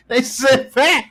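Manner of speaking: very high, loud, cracking up; laughing. Retold or imitated. Telling friends about hitting a kid too hard, laughing. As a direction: tell it helplessly through laughter. Laughing through a childhood tackle story.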